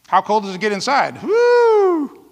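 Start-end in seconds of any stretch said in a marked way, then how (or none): 1.2-2.3 woo. Acted. Cold reaction exclamation.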